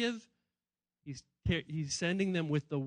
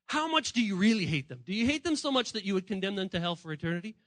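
ordinary talk

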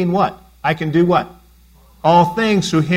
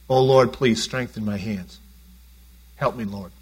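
low, soft plea for help; quiet pleading prayer; 8 semitones lower, 5 dB quieter than usual. Retold or imitated. Pastor voicing Nehemiah's prayer, softly pleading. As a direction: beg the Lord quietly for strength. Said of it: "He prays".

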